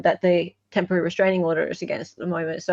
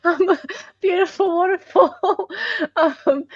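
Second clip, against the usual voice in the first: laughing; 10 semitones higher than usual. Real, not acted. Laughing while reading screen.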